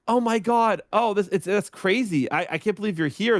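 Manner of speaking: high, excited gushing fan; starstruck fan. Retold or imitated. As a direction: gush like a fan meeting a star.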